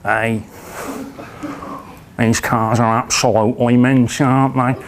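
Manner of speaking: put-on Manchester lad accent, low; swaggering Manchester accent. Manchester lads strolling into an Aston Martin dealership, Manchester accent. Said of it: "they were from Manchester, so they walked in like this".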